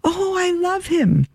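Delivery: high, drawn-out mock shout; retold shout. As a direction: call it out high without real volume. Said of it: "and I shouted".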